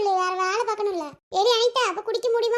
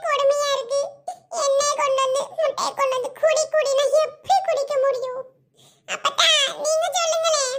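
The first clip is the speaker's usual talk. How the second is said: put-on whining girl; 4 semitones higher than usual. Daughter whining, pleading to grandmother.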